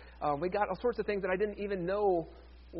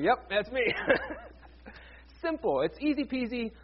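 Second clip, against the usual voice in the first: amused laughing; 5 semitones higher than usual. Real, not acted. Joking, laughs through the words.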